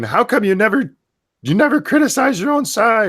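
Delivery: high-pitched imitation of a complainer; mock complaint. Quoting Matt challenging someone about their side, high mock complaint. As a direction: whine it high, mimicking an accuser. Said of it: "Matt came in".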